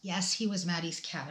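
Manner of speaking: ordinary talk, nothing marked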